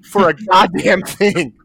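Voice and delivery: loud, high exasperated rant; comic outrage. Real, not acted. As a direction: protest loudly in disbelief. Ranting about the absurd NDA demand.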